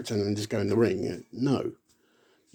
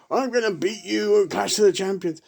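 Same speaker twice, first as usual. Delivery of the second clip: higher mock wrestler promo; imitating Sid; 9 semitones higher than usual. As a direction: ham up the in-character promo. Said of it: "Sid carries on going".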